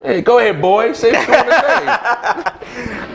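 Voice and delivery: squeaky high, slow laughing egging on; mock hype man. Egging his friend on to speak up, high and laughing. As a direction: cheer him on in a silly high voice.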